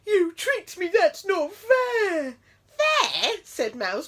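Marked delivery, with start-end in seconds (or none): 0.1-2.4 loud, much higher, very slow outburst; flabbergasted bear. A narrator's reading. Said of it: "Bear was flabbergasted". 2.8-3.4 very high loud squeak; cheeky mouse. A narrator's reading. Mouse retort.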